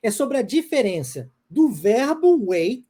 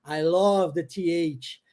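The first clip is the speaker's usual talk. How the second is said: slow model phrase; 4 semitones lower, slower than usual. Shown as a demonstration. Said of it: "a gente vai falar assim".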